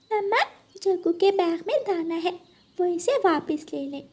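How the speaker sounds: put-on little girl's high tattling tone; a lightly voiced small child tattling. Kuku tells mom about the grain in Jaggu's bag, high child voice.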